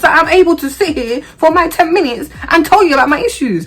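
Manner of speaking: high, loud, fast indignant rant; assertive rant. Retold or imitated. Patient ranting at her doctor, high, loud and indignant. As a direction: let it all out at the doctor. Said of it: "Because I'm being assertive".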